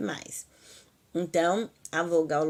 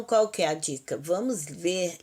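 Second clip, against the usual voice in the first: ordinary talk, nothing marked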